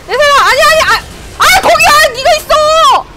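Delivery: high, loud frantic yelling; frantic shout. Real, not acted. Streamer chasing an enemy, frantic high shout. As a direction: shout it as the target slips away.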